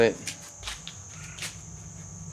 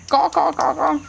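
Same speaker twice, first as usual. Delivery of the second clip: cawing; 4 dB louder than usual. Pretend. Imitating the birds.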